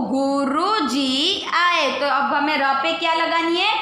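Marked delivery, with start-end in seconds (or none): 0.1-2.0 Guru drawn out slowly, each syllable clear; slow reading aloud. Shown as a demonstration. Teacher modelling a phrase to young learners, slow. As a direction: spell it out in sound for the kids. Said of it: "तो क्या हो जाएगा?".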